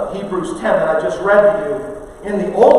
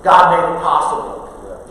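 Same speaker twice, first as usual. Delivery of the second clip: ordinary talk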